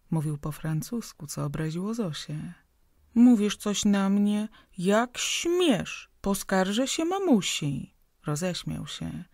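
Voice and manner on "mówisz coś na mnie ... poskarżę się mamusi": put-on higher, louder indignant little girl; offended child. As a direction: play the huffy child threatening to tell mum. (obrażone dziecko, wyżej i głośniej)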